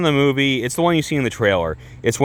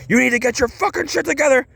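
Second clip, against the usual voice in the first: high, strained menacing outburst; acted high-pitched furious order; evil for a second; 13 semitones higher than usual. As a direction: imitate a coach tearing into a player. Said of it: "he's, like, evil for a second".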